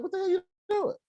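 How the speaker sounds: high-pitched retold challenge; incredulous, high outburst; mock exasperation. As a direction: re-enact confronting a friend, voice shot up high. Re-enacting confronting Grace.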